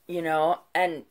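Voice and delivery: ordinary talk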